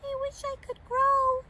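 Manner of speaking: high, tiny wistful voice; wee pixie voice. A narrator's reading. Pixie sad at being too small, wee voice. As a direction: wish it in a little squeak. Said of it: "in a wee voice, whispered".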